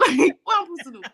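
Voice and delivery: fast and loud through laughter; laughing helplessly. Real, not acted. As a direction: laugh the question out. Laughing retelling.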